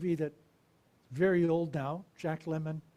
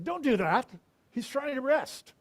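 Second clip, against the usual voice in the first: sharp, loud, higher scolding; scolding disciples; 8 semitones higher, 8 dB louder than usual. Retold or imitated. Disciples scolding the parents away from Jesus, loud and sharp.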